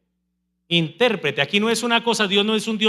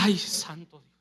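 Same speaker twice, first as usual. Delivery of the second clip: mock frightened gasp; 4 semitones lower, 10 dB quieter than usual. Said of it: "usted comienza".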